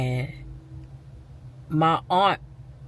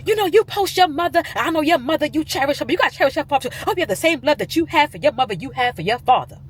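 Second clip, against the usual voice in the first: imitating aunt; 8 semitones higher than usual. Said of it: "She was like".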